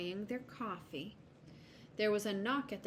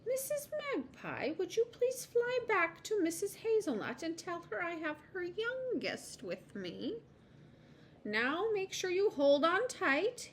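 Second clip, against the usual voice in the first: high-pitched squirrel making a courteous request; polite squirrel; 10 semitones higher than usual. Acted. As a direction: ask nicely, then instruct the little one. Voices Mister Squirrel giving instructions.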